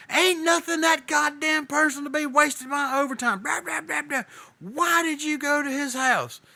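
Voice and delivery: mock rant, voice cranked way up; screaming boss. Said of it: "He's screaming".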